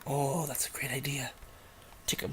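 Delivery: whispered dry sarcasm to yourself; sarcastic whisper. Real, not acted. Streamer mocking own face cam idea, sarcastic whisper. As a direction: whisper it, rolling your eyes.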